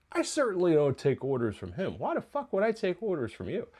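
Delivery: acted-out affronted comeback; indignant retort. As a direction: imitate someone refusing to be bossed around. Acted indignant refusal of orders.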